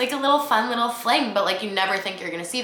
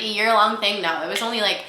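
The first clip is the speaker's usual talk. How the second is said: ordinary talk, nothing marked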